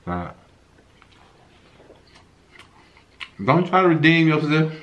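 chewing while talking; talking between bites; mouth full. Real, not acted. Seen 0: Unsure who speaks; both are eating sandwiches, the man seems to chew while talking.

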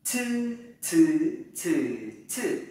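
"Thư" as a puffed ts, through four tones; tongue straight, burst air on each "thư"; aspirated flat-tongue c. Shown as a demonstration. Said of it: "chúng ta làm lại lần nữa". (đọc "thư" thẳng lưỡi, bật hơi)

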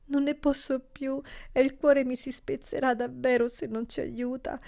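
high, tearful cries to the Madonna; desperate weeping plea. A narrator's reading. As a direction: sob out a desperate protest. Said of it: "pronunciò il nome della Madonna con due gridi pieni di una disperata protesta". (grido disperato in lacrime)

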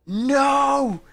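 high, loud cry of "no!"; sudden shout. Real, not acted. Streamer cries out at something on screen in a high, loud shout. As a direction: yelp it out in shock. Sudden outcry mid-sentence.

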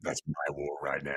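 deep, low, heavy Austrian action-star growl; Schwarzenegger impression. Joking to his co-host about Quick Ben, deep Schwarzenegger growl. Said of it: "you need the Schwarzenegger moment".

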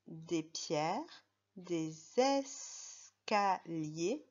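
des escaliers read very slowly, syllable by syllable. Shown as a demonstration. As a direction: stretch out des escaliers for a child learning to read. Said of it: "je m'arrête une seconde".

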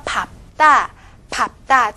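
밟다 said bap-tta, stopped p and tense tt; right-consonant reading. Shown as a demonstration. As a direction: punch out 밟다 loudly as bap-tta. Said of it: "是读这个右边的".

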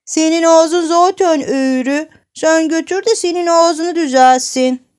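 put-on high, loud child's jeer; taunting retort. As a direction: throw the granny's insult back at her. Çocuk nineye alayla karşılık veriyor. (yüksek sesle, alaycı çocuk karşılığı)

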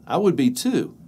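ordinary delivery

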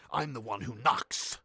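slow, drawn-out tough line. Acted. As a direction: put on a measured, imposing delivery.

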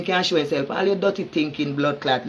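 normal talking voice

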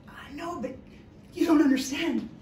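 retold protest. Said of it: "And I thought".

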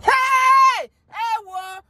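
high, loud drawn-out cry; mock outraged shout. People reacting to him saying president, mock outraged shout. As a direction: imitate a scandalized crowd's yell.

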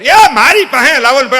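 high, loud fussy grandmother; grandmother demanding baby. Acted. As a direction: demand the baby be handed over. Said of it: "તા તો grand mother". (દાદીનો ઊંચા અવાજે આગ્રહ)

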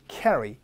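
"Carry" with a wide first vowel, lips pulled sideways; spread-mouth vowel. Shown as a demonstration. Teacher modelling for the class, "Carry" with a wide-spread vowel. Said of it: "입 양쪽으로 당겨주시고요".

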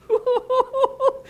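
high hooting laugh; exultant whoop. Real, not acted. Preacher delighting in the promise, high hooting laugh. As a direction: let out a string of high hoots.

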